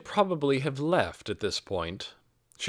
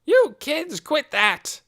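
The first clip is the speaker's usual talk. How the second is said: put-on old woman yelling high and loud out the window; shrill-free loud old lady call, much higher pitch; crying out; 7 dB louder, slower than usual. A narrator's reading.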